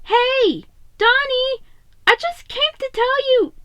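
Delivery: put-on high, bright call of a young kid bursting in; cheerful little brother. A narrator's reading. Mikey bursting into the restroom calling to Donnie cheerfully. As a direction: call out happily to your big brother. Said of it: "Mikey cut himself off".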